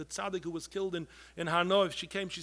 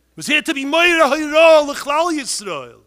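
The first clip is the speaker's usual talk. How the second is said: fiery shout; 8 semitones higher, 15 dB louder than usual. Real, not acted. Preacher thundering at the crowd to act. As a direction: shout it out, high and loud.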